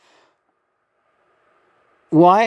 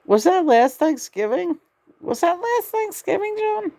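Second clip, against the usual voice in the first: very high, squeaky questioning; high-pitched pestering. Real, not acted. Pestering a silent companion, high-pitched. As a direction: climb higher as the question repeats.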